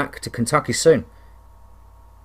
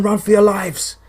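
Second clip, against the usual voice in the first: screaming; 8 semitones higher than usual. Acted.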